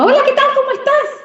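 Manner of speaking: very high, chirpy greeting; squeaky high hello; high-pitched demo voice. Shown as a demonstration. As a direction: pitch it way up, bright and crisply articulated. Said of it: "que puedo hablarlo muy articulado".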